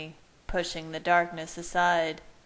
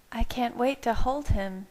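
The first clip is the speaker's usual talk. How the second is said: higher voice for Bella, dreamy; Bella musing; 6 semitones higher than usual. A narrator's reading. Bella muses about holding the baby, higher voice. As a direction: muse aloud in a higher voice. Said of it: "she mused".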